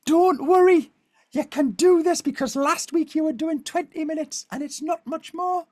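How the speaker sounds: high-pitched northern English encouragement; Sarah Millican reassuring. Retold or imitated. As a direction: soothe in a high northern coach voice. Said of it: "she talks like this".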